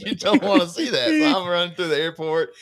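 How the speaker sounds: high, loud laughing through the words. Real, not acted. Laughing to friends about running through the airport. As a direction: laugh it out at your own joke.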